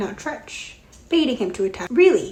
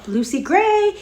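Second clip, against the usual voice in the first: louder, higher frustrated call; mild bellow; 9 semitones higher, 5 dB louder, slower than usual. A narrator's reading. Snow lost in the woods, bellowing after Lucy Gray. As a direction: shout her name, fed up. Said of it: "he bellowed in frustration".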